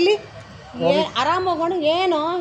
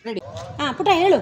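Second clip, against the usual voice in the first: usual speaking voice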